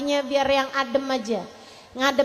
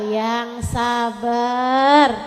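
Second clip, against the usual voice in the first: "yang sabar" stretched very slowly; drawn-out advice; 3 semitones lower, much slower than usual. Shown as a demonstration. Ustazah answering complaining women, very slow. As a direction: drag out "yang sabar" patiently. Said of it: "Ustazah, saya dijahatin sama ipar".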